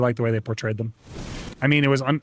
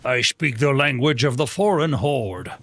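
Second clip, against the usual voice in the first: drawn out like a mock warlord from a fantasy show; mock barbarian; slower than usual. Mock grand foreign-invader voice.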